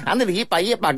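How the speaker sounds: high cartoon mouse impression; squeaky cartoon Mexican mouse; Speedy Gonzalez voice.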